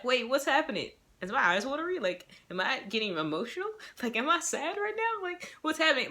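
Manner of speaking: mock surprised tears. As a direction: play it like tears creeping up unexpectedly. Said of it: "and you're like".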